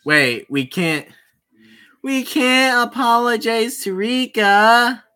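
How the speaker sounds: usual speaking voice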